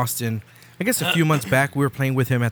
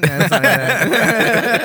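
laughing; 7 semitones higher, 5 dB louder, slower than usual. Real, not acted. Laughing after mock accent.

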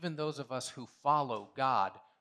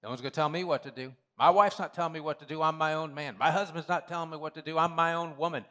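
a touch of defiant, louder swagger; rebellious defiance; 3 semitones higher, 6 dB louder than usual. Retold or imitated. Preacher imitating a stubborn churchgoer, defiant and loud. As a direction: play the stubborn self who won't be told. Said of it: "I have a rebellious spirit".

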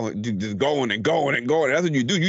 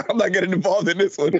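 imitating a friend; 7 semitones higher than usual.

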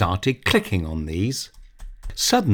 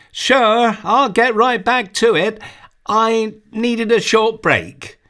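put-on much higher, slower boy voice; boy answering his father, much higher and slower; young boy Tony; 12 semitones higher than usual. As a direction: play the nine-year-old brushing Dad off.

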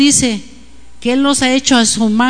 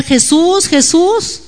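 higher, half-shouted call for Jesus; imitated cry; 6 semitones higher, 4 dB louder, much slower than usual. Blind man calls to Jesus from the crowd, imitated crying out. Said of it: "le empezó a gritar".

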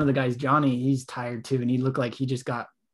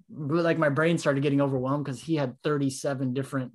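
ordinary talk

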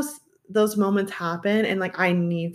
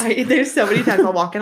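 usual speaking voice

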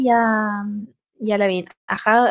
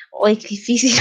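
ordinary talk, nothing marked

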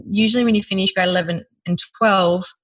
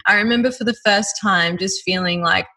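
ordinary delivery

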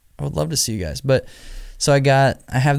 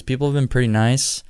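ordinary talk, nothing marked

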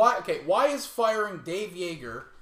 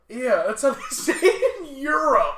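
laughing loudly through the words, voice climbing high; teasing laughter; 7 semitones higher, 6 dB louder than usual. Real, not acted. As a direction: crack up while teasing your co-host. Teasing co-host's pronunciation claim.

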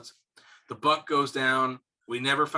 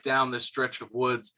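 ordinary talk

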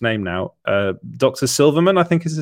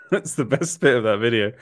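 ordinary talk, nothing marked

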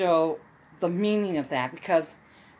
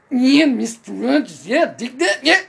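Jesse Ventura; 3 semitones higher, 7 dB louder than usual. Retold or imitated. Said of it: "My first voice was Jesse Ventura".

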